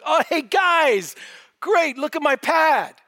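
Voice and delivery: high, breezy mock-casual greeting, slow and drawn out; mock casual Joseph.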